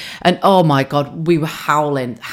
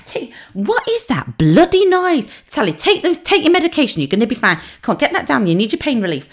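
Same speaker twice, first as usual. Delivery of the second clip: much higher, bossy mum voice; mum in hospital; 10 semitones higher than usual. Retold or imitated. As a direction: nag like a ward sister mum. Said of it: "She's like".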